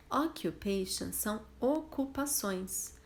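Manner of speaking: usual speaking voice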